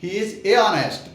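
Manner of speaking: "He is a honest" said slowly, halting between a and honest; awkward "a honest" with a pause. Shown as a demonstration. Teacher demonstrating the clumsy "a honest" to students, halting and slow. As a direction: stumble over "a honest" as if it's hard to say. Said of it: "तो थोड़ा सा रुक के बोलना पड़ेगा".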